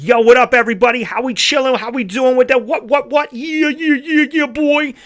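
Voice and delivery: high, loud hype-man stage voice; put-on stage persona. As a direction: shout it out like a hammed-up hype act. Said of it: "that's not how I speak to them".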